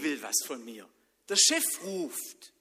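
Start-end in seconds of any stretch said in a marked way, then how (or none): 1.3-2.5 put-on high, slow, louder, eager boy's voice. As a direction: announce it like a child jumping up. Samuel thinks his boss calls. (eifrig und langsam, hoch)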